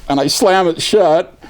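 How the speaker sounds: ordinary talk, nothing marked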